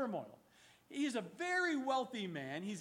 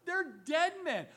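emphatic; 6 semitones higher, 7 dB louder than usual. Acted. Preacher stressing the brothers' doom.

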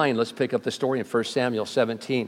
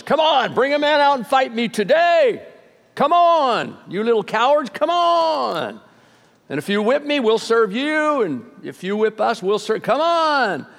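Goliath taunting; 8 semitones higher than usual. Retold or imitated. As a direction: jeer at them like a bully daring someone to fight. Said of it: "Basically, what he was saying was".

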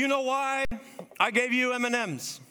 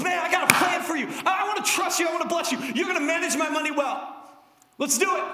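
God's voice, higher and louder, eager and promising; eager shout; 6 semitones higher, 3 dB louder, faster than usual. Acted. Preacher voices God calling out his plan for the congregation, eager shout. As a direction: shout it like an excited father with a plan.

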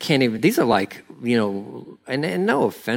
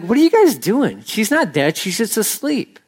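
higher-pitched exasperated question; walk in and challenge the room; mildly exasperated acted protest; incredulous challenge; 6 semitones higher than usual. Acts Jesus confronting the mourners.

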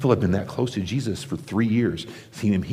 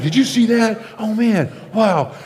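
much higher and louder, gushing amazement; excited gossip; 14 semitones higher, 4 dB louder, slower than usual. Retold or imitated. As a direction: gush like someone who just saw a miracle. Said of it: "we'd be like".

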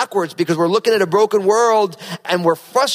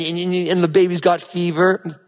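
ordinary talk, nothing marked

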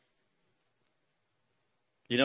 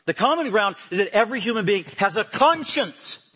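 building louder and higher toward a peak; rising preaching; 10 semitones higher, 6 dB louder than usual. Acted. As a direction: swell the sermon to its height. Preaching climbs to full force.

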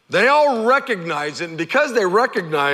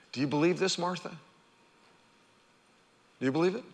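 softer, hushed asking; soft question; 10 dB quieter, much faster than usual. Shown as a demonstration. Jesus asking Martha, hushed gentle question. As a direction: ask it gently, almost to herself. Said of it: "the question is for us today".

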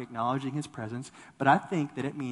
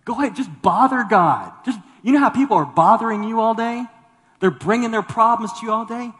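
preacher raising his voice, urging them on; loud urging; 7 semitones higher, 13 dB louder than usual. Real, not acted. Preacher to congregation, loud fervent urging. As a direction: shout it out to the congregation to go bother God.

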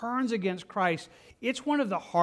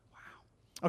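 soft unvoiced exhale of the word; breathy whispered wow; 8 dB quieter than usual. Real, not acted. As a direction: let it out as a whisper. Breath after heavy scene.

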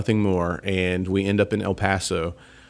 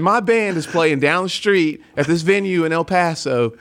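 put-on South Carolina accent kept up; 6 semitones higher than usual. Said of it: "I lay it on thick".

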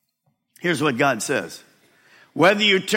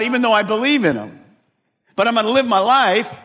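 rising, louder preacher's build; full-force preaching; 7 semitones higher, 5 dB louder than usual. Acted. Pastor driving the point home to the church, full force. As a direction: preach it harder line by line.

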